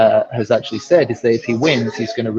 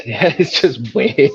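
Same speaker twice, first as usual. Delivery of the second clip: laughing; 3 semitones higher, slower than usual. Real, not acted. Teasing co-host about lollipop.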